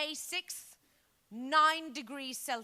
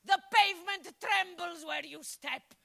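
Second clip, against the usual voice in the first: shouted compliment; 4 semitones higher, 5 dB louder than usual. Retold or imitated. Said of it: "The driver honked and shouted".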